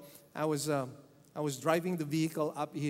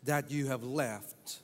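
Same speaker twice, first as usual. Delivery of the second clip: slow, soft reading of the verse; scripture reading; 5 semitones lower, 4 dB quieter, slower than usual. Shown as a demonstration. Pastor reads the rebuke to his congregation, slow and soft. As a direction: lay the verse out quietly and slowly. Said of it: "Verse four reads".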